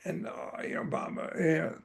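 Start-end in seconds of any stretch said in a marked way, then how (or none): none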